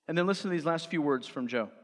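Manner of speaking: ordinary talk, nothing marked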